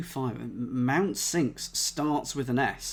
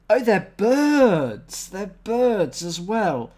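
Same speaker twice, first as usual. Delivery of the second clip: excited realization; 5 semitones higher, 7 dB louder, much slower than usual. Real, not acted. Cracks the crossword clue.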